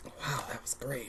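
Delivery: sarcastic whisper. Real, not acted. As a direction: whisper it dryly. Deadpan aside.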